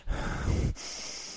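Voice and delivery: imitating the barber's heavy, unvoiced breath. Barber overheated mid-haircut, imitated heavy breathing. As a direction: huff out a long breath like an overheated man. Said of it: "he starts breathing like this".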